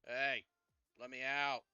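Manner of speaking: loud, muffled call from a box. Acted. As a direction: shout from inside a coffin. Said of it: "a muffled, like, voice from the main coffin".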